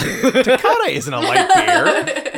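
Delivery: high slow laughing protest. Real, not acted.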